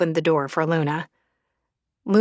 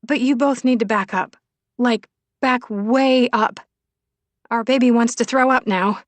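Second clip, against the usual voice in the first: higher, louder warning; urgent queasy Willow; 5 semitones higher, 4 dB louder than usual. Acted. Willow warns friends she will throw up, urgent. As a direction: order them back emphatically.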